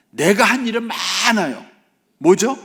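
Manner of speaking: high, loud, drawn-out boast; hammed-up self-righteous son; aggrieved elder son. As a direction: protest loudly, stretching the words high. Voicing the elder son's boast.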